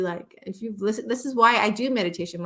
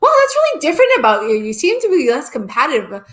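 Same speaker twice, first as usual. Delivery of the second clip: higher, louder, fast, an amazed onlooker; surprised remark; 5 semitones higher, 9 dB louder than usual. Retold or imitated. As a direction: blurt it out as if struck by the change. Someone noticing the change in you.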